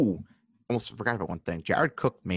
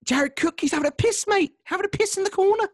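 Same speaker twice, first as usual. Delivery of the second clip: high-pitched, put-on Scouse; Liverpool alter ego; 4 dB louder than usual. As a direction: squawk it in an exaggerated Liverpool accent. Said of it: "The alter ego from, uh, Liverpool".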